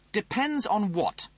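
high, snooty officer voice; pompous Rimmer voice. Acted. Rimmer asks.

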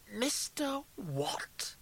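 hushed, slow, high-pitched incredulity; stunned disbelief. A narrator's reading. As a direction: echo it as if you can't believe it. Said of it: "He half smiled in disbelief".

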